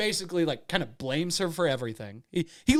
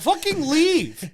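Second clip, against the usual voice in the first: high, loud, drawn-out mock wail; hammed-up exasperation; 9 dB louder, slower than usual. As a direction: imitate her fed-up outburst, slowly. Retold bachelorette gives up, distraught.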